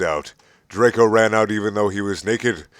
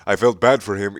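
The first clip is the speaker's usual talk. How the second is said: plain reading voice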